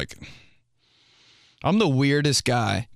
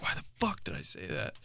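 whispering to yourself in regret; whispered self-scolding; 7 semitones higher, 13 dB quieter than usual. Retold or imitated. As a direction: hiss it out under your breath. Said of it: "and be like".

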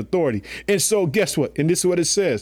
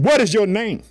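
raised, stern question; Jesus demanding; 6 semitones higher, 4 dB louder than usual. Acted. Jesus questioning the demon, raised and stern. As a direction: demand the name up high. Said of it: "And Jesus asked him".

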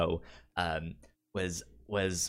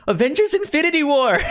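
put-on fast, high, much louder kid shout; eager blurt; 12 dB louder, faster than usual. Kid shouting his answer at the math teacher, high eager mock shout. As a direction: yell the answer like an overexcited kid.

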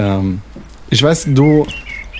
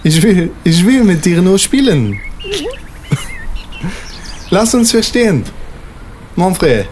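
put-on higher, pleading in broken French-accented German; 4 semitones higher than usual. French father-figure begging his lover's son, French accent pleading. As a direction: beg in a French accent, pitched up.

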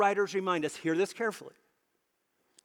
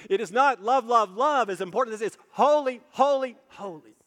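not love, but holy, holy, holy, rising and louder; emphatic proclaiming; 3 semitones higher, 5 dB louder than usual. Acted. Preacher correcting the congregation, not love but holy. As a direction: proclaim HOLY with growing force.

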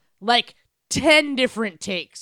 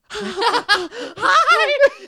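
a squeaky, higher mock "Hi"; silly high hi; 11 semitones higher than usual. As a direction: chirp it like a sheepish ghost. Imagined Hayden ghost greeting.

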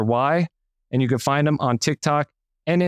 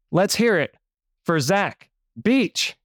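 announcer hype; 5 semitones higher, slower than usual. Acted. Host introducing guest, game-show style.

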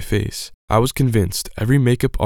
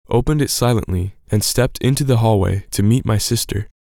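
ordinary delivery, nothing marked

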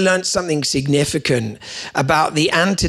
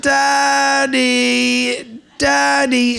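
put-on high, drawn-out little child's call of "Daddy"; small child calling for Dad in the dark; child calling at night; 8 semitones higher, much slower than usual. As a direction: call out like a sleepy kid from the next room. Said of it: "the voice went something like this".

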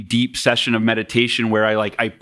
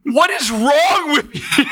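high, slow incredulous imitation, breaking into laughter; mock outrage; 13 semitones higher, slower than usual. Woken wife scolding her bawling husband, mock outrage. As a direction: act out baffled outrage, half laughing. Wife's reaction to being woken.

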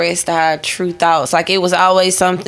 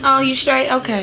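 ordinary delivery

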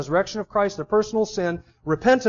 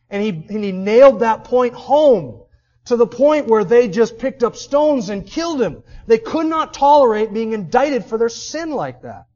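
louder, slower, higher, pressing every word home; building sermon intensity; 4 semitones higher, 7 dB louder than usual. Real, not acted. Preacher to congregation on Stephen's fate, building preaching force. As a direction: preach it like the crowd is turning.

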